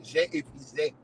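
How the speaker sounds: soft French repeat; French phrase. Acted. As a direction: mutter j'ai épuisé again in French. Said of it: "as the French would say".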